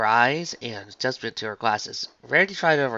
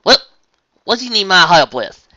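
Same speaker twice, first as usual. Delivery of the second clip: loud, higher country twang; eager southern farm pony; 4 semitones higher, 11 dB louder than usual. Acted. Farmer asks what help is needed, eager twang. Voices Applejack offering help.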